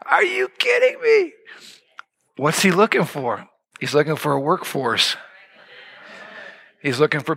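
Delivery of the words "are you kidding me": high-pitched incredulous outburst; mock disbelief. Preacher acting amazed to the congregation, high incredulous squeal.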